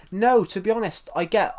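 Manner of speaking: usual speaking voice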